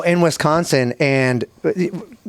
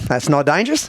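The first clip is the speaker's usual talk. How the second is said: imitated dismissive Aussie; Australian accent. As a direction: brush it off like an Australian bloke. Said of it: "Pretty, pretty good Australian accent".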